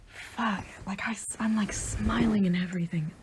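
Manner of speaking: breathless whisper, holding back emotion. Real, not acted. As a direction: whisper it, trying not to cry. Overcome while showing passport photo.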